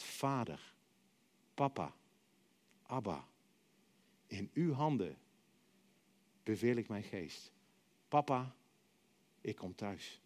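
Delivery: soft and low, fading toward the end; tender hushed trust; quiet surrender. Retold or imitated. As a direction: let go gently, like a child coming home. Jesus' last trusting words.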